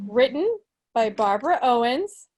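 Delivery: ordinary talk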